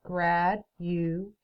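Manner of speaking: slow, each syllable split apart; Grad, u, a, shun, one by one; syllables clapped out. Shown as a demonstration. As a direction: clap out every beat of the word. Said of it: "Let's count how many syllables it has".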